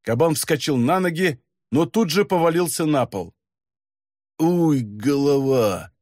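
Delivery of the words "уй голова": slow pained groan; hungover groan. Acted. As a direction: groan through a pounding head. Hungover demon wakes groaning. (похмельный стон)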